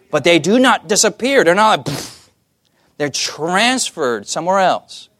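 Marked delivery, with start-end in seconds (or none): 1.9-2.3 short pfft of air; imitated vanishing puff. Imitates sins vanishing into thin air.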